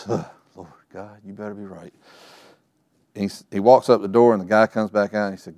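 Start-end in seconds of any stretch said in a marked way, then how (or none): 0.1-1.9 muttered prayer. Retold or imitated. Father praying under his breath.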